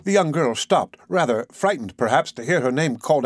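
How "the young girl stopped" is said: ordinary delivery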